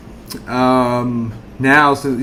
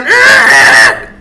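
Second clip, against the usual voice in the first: high, loud screeching "argh"; car squeal imitation; 7 dB louder than usual. Homeowner mimics the car squealing in the yard, loud screech.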